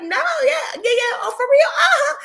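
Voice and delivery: high, loud, laughing yes-yes; hammed-up eager agreement, voice way up; playful agreement. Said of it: "we're having a playful conversation".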